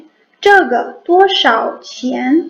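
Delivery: "这个多少钱" very slow, each syllable apart; slow Mandarin model sentence. Shown as a demonstration. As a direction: spell out "这个多少钱" with clear tones. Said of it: "अब इसको एक हम sentence में इस्तेमाल करते हैं". ("这个多少钱" बहुत धीरे, साफ़ टोन में)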